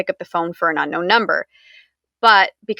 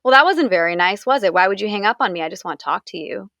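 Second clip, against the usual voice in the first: ordinary talk, nothing marked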